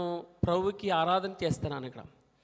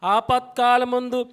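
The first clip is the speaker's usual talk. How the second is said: loud, ringing congregational recitation; louder call-and-response verse reading; preaching at full force; 4 semitones higher, 9 dB louder than usual. Acted. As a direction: lead the church in reading the verse, loudly. Leads congregation reading together.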